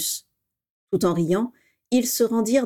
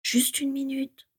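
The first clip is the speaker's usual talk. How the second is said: soft, higher, slow whisper; pleading whisper, softer and slower; 6 semitones higher, 6 dB quieter, slower than usual. Acted. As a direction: plead in a hushed voice. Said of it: "chuchota James à son tour".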